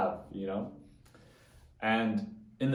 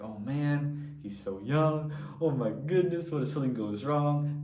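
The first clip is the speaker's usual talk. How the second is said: retold fretting, higher pitched; a light imitation of his first-day nerves; mock worry; 6 semitones higher than usual. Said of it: "I was freaking out".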